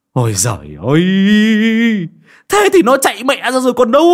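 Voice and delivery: hammed-up despairing outburst; exasperated wail. A narrator's reading. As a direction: groan rising into a very high, loud wail. Exasperated man beats chest, stamps feet.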